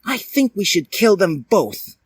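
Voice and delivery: high put-on girl voice; a high girly voice with a cold plan; Dally's voice. Dally tells Edward to kill them both, in a high girly voice. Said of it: "told Edward".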